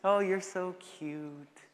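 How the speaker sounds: drawn-out mock-sweet cooing; slow, gushing pretend laugh-along; fake amused approval. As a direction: coo it slowly with heavy sarcasm. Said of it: "sit there and be like".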